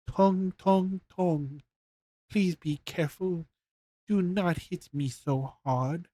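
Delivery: high, small squeaking voice; tiny squeaky voice. A narrator's reading. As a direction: squeak like a tiny wood spirit. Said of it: "tiny voice squeak".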